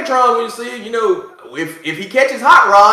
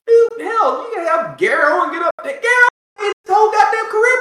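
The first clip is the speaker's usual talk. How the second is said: worked-up rant; 12 semitones higher than usual. Real, not acted. Heated rant about comedian.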